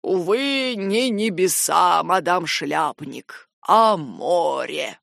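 put-on low, very slow old sailor's rasp; hoarse croak. A narrator's reading. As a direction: croak it out gravely, word by word. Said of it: "прохрипел лорд Витлуф". (низкий медленный хрип)

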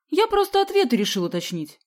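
high, loud self-justification; defensive protest. A narrator's reading. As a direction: protest innocence in a squabble. Сидякин оправдывается в перепалке.

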